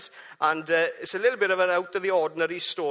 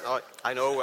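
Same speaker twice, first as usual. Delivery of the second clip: usual speaking voice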